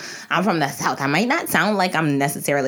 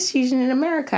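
ordinary delivery

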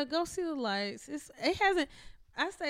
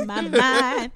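loud, forceful mock of the singer; strong belted line; 2 semitones higher, 10 dB louder, slower than usual. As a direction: belt it out way too strong. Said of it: "why is he saying it so strong like that".

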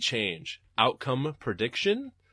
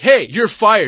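mock boss; 8 semitones higher, 15 dB louder, slower than usual. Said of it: "your boss coming up to you and saying".

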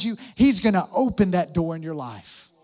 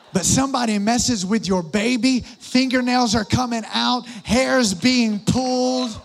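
louder, higher, fired-up preaching; rally the room at full force; 5 semitones higher, 7 dB louder than usual. Acted. Pastor fires up the moms, louder preaching.

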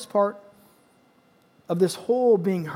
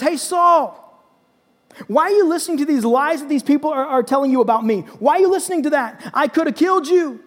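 high, insistent challenge; calling out; 5 semitones higher, 4 dB louder than usual. Acted. David confronts Saul about the lies, calling out. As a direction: confront him in a raised, indignant voice.

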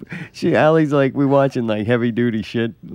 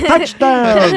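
high loud touchdown call; announcer call; 13 semitones higher, 7 dB louder than usual. Pretend. Joking about Tecmo Bowl, mock touchdown call. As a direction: call it like a sportscaster.

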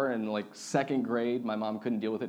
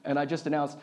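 ordinary talk

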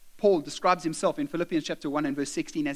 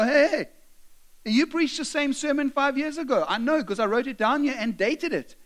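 raised voice, high and challenging; mock indignant call-out; 7 semitones higher, 3 dB louder than usual. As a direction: call the preacher out, voice pitched up. Acted congregant challenging the preacher.

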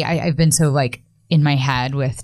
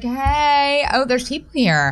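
put-on little girl voice; 9 semitones higher, much slower than usual. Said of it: "She's like".

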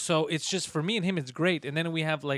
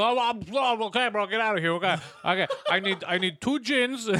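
higher, louder mock bouncer voice, laughing through it; pushy bodyguard; 6 semitones higher, 4 dB louder than usual. Friend imitating their pushy security guy, hammed-up laughing. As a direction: shove past people like a careless bodyguard. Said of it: "So he'd push people".

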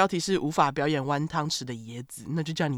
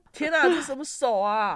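high laughing disbelief; 8 semitones higher, 3 dB louder than usual. Real, not acted. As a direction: laugh out baffled. Baffled by emoji.

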